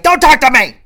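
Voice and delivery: sudden loud, high-pitched yell; angry outburst. Retold or imitated. Angry wife to husband in the morning, furious outburst. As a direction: explode at full volume, acted fury.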